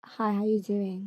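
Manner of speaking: slow, softened British accent copy. Shown as a demonstration. Guest copying the host, soft slow British accent. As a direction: try it softly in a British accent. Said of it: "Try it, try it".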